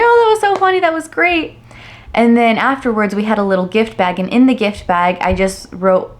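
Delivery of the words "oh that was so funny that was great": high-pitched, mock-delighted reaction; delighted family impression, pitched high; gushing praise. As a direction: imitate relatives gushing, leaping up in pitch. Acting family's reaction to the video.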